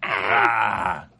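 slow, drawn-out mock-mad voice; lightly hammed-up angry creature; imitated angry nanite. Angry nanite Priscilla returns, mock angry voice. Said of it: "that voice".

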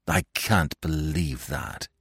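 slow, doubtful reply; slow and unwilling; slowly. Acted. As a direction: drag the words out in disbelief. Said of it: "I said slowly".

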